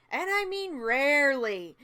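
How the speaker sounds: slow, loud rarely; stress on rarely. Real, not acted. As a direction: lean on rarely, slowly. Insisting on rarely.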